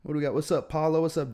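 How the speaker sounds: normal talking voice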